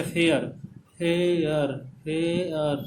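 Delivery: फेयर stretched, gliding slowly from ए to अ; diphthong demonstration. Shown as a demonstration. Teacher showing students two vowels in फेयर, drawn out. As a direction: draw out फेयर to expose both vowels. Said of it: "ए और अ का sound".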